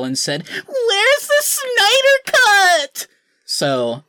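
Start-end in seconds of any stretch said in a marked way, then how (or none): 0.5-3.1 very high, loud drawn-out shriek; exasperated scream. Retold or imitated. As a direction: scream it out in hammed-up despair. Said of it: "started banging my head against the wall".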